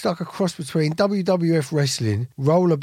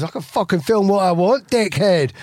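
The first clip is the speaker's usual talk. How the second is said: louder, higher taunting threat; aggressive bully; 5 semitones higher, 4 dB louder than usual. Retold or imitated. Bully taunting a homeless man on camera, mock aggressive. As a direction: square up like a bully goading someone. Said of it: "And he goes".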